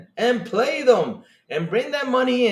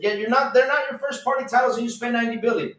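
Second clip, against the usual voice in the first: ordinary talk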